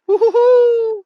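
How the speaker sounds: loud, very high, long woo-hoo; excited whoop. Real, not acted. Whooping that springtime is near.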